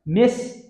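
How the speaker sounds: four spaced beats in Mischievous, loud high start; common error. Shown as a demonstration. Teacher demonstrating the common error, extra syllable. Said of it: "Common error".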